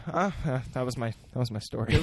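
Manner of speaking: usual speaking voice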